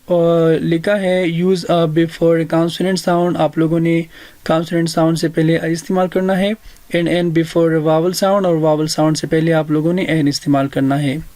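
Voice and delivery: normal talking voice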